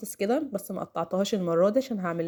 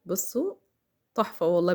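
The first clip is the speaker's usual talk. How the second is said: usual speaking voice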